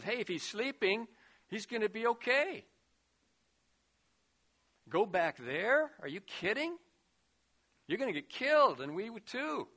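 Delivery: protesting anxiously, voice rising; worried disciples. Retold or imitated. Preacher voicing disciples begging Jesus not to go back, alarmed.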